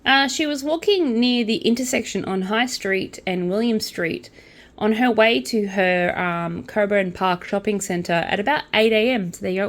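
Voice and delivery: ordinary talk, nothing marked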